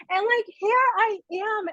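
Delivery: breathless wonder at her own story; excited disbelief. Real, not acted. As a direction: marvel at where you've ended up. Overwhelmed at her own success.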